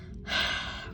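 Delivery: soft breathy sigh. A narrator's reading. Tired mom sighing about the dinosaurs, softly. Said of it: "My mom sighed".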